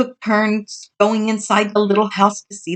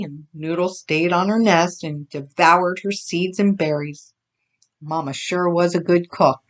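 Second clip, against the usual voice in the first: ordinary delivery, nothing marked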